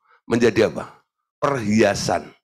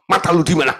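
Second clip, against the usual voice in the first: loud, blunt Batak yell; loud, indignant yell at a clumsy stranger; angry shout; 6 semitones higher, 6 dB louder, faster than usual. Retold or imitated. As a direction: bark at the person who stepped on your foot. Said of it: "dia langsung teriak".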